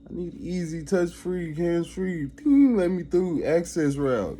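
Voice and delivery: high, whiny demanding mock; mocking an entitled mindset, high and whiny. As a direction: beg for the easy way like a spoiled kid. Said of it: "mind frame of".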